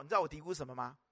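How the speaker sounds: normal talking voice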